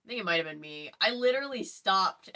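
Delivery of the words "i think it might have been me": ordinary talk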